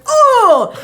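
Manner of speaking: loud, high "oh" as if hit in the stomach; high-pitched "oof" re-enactment; retold pained "oh". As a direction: cry out from a sudden blow. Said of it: "when he went, 'Oh!' impersonating it".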